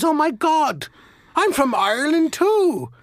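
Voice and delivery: put-on excited Irish accent. Second Irishman thrilled to meet a countryman, high excited brogue. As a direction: squeal it with Irish glee. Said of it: "identify with the accent".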